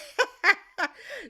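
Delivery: cracking up mid-sentence; real laugh. Real, not acted. Laughing at Rob's flip.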